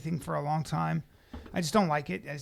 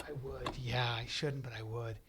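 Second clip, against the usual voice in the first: low, soft retold confession; hushed admission; 7 semitones lower, 9 dB quieter than usual. Woman on a plane accepting ice cream, hushed admission. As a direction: admit it under your breath.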